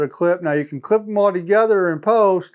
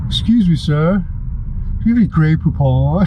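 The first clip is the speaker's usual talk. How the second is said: mock posh request. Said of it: "wants to roll down your window and say".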